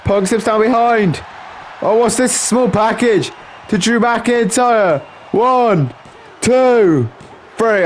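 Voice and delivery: high-pitched wrestling commentary; excited play-by-play. Acted. Commentator calling Punk's surprise small package, excited high play-by-play. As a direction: call the pinfall with rising excitement.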